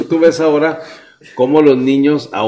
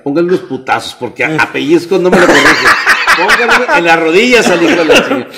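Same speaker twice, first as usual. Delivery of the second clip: loud angry father; 4 semitones higher, 6 dB louder than usual. Retold or imitated. Father tells teacher to hit.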